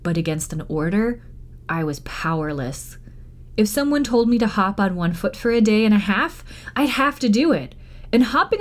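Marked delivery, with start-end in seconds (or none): none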